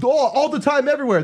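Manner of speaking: very high imitated accent; Gaston accent impression. Comedian imitating a guy from Meltdown, accent impression. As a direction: put on an excitable, high-pitched accent. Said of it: "And then I said".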